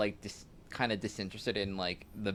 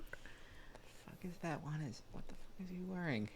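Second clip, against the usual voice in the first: whispering; 6 semitones higher, 12 dB quieter than usual. Pretend. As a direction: mutter it without voice. Whispering about the stranger's outfit.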